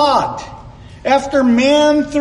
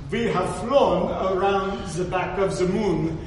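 put-on botched Russian accent, more French; 3 semitones lower, 5 dB quieter than usual. Preacher quoting a Soviet cosmonaut, failed Russian accent sounding French. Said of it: "that's more of a French accent".

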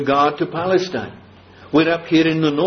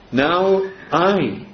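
I held long and set apart; emphatic I; 3 semitones higher than usual. Shown as a demonstration. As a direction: give "I" a long, weighty beat. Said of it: "here's an emphatic".

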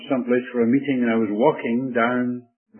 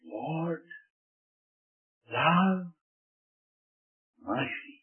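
puzzled murmur; 3 semitones higher, 8 dB quieter, much slower than usual. Retold or imitated. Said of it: "He couldn't bring those two things together".

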